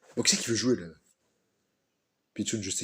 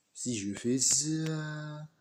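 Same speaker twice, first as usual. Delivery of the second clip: very slow; 3 semitones higher, much slower than usual. Shown as a demonstration. Testing sound, drawn out.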